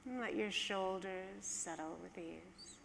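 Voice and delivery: calm, hushed guide easing listeners down; guided meditation. Acted.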